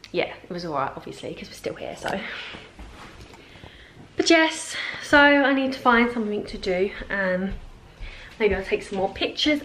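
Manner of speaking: usual speaking voice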